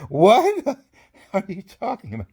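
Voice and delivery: high, slow, laughing protest; laughing mock disbelief. Soldier questioning his orders, laughing mock disbelief. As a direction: draw it out high through laughter.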